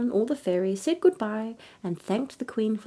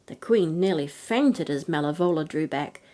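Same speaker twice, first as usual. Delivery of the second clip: ordinary delivery, nothing marked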